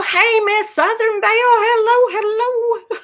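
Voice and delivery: high, bright hello calls to a newcomer; excited greeting. Real, not acted. Host greeting a viewer who joined her live, high and delighted. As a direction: call out a delighted welcome, pitched high.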